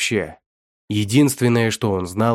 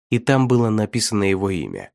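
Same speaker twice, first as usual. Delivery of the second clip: plain reading voice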